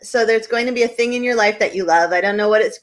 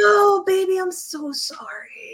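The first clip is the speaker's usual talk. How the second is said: oversweet, stretched-out pity; mock sympathy; 9 semitones higher, slower than usual. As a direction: coo a hammed-up apology to your partner. Mock apology after partner complains.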